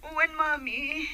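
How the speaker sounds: weak and weepy, answering mom; tearful. Acted. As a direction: choke the words out through tears. Sick daughter answering her mother.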